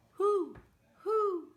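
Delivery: high, loud owl hoots. Shown as a demonstration. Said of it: "You follow Miss Debbie".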